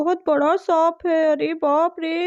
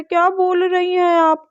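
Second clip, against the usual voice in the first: ordinary talk, nothing marked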